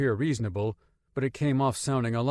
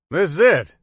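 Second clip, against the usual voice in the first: much louder booming call; loud declaration; 6 semitones higher, 11 dB louder than usual. Acted. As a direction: call it out proudly. Marine speaks up loudly.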